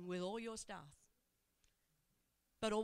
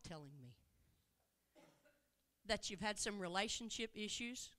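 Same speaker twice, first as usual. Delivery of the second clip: tender reassurance; 3 semitones lower than usual. Acted. Jesus speaks tenderly to woman.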